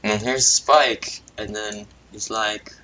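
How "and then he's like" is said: usual speaking voice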